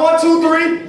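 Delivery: one two three, pushed harder. Shown as a demonstration. Speaker drives the crowd's final count, loud. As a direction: give it everything on the last count. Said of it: "Last time".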